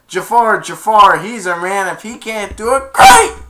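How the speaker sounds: high, loud jeering cheer; mocking genie. The genie cheering against Jafar, loud high mockery. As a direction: tease the villain with glee.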